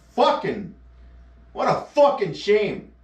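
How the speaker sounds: ordinary delivery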